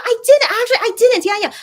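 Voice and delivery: high, loud flustered correction; flustered surprise. Real, not acted. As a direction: catch yourself mid-answer. Flustered answer about fan cafe message.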